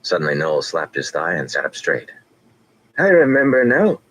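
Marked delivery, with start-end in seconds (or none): 3.0-4.0 high, loud burst of recall; old man struck by a memory; sudden remembering. A narrator's reading.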